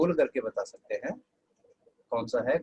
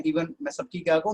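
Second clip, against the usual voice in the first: ordinary talk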